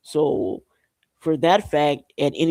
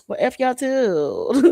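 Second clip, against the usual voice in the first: mock defiant retort; 8 semitones higher, much slower than usual. Acts out departing officials' retort.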